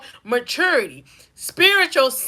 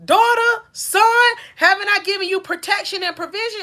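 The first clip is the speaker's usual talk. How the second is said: voice of God; 8 semitones higher than usual. Acted. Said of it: "God gonna say".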